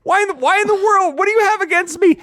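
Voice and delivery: very high, loud mock pleading; bewildered protest. Driver asks bush what it has against him, mock-wounded wail.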